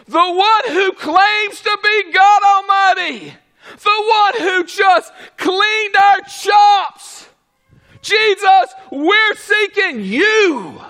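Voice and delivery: loud, high, ringing sermon voice with shouted peaks; preaching at full force. Acted. Preacher shouting to the congregation, fiery full-force preaching. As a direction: drive it home like a revival preacher. Preacher mocking the soldiers' return.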